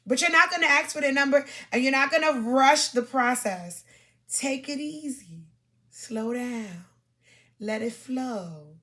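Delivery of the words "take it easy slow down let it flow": slow, soft and soothing; slow calm. Shown as a demonstration. Advising women not to rush a date, slow soft calm. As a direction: ease them down, slowly and gently. Calming advice to viewers.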